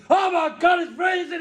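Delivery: high, loud shouted penguin; freezing penguin. Acted. Comedian voicing the freezing penguin, shouting high. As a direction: yell it like a frozen penguin.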